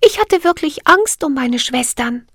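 very high, clear little fairy voice; bell-like fairy voice. Acted. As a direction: ring the words out like a tiny bell. Siri voiced in story.

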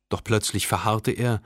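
ordinary delivery, nothing marked

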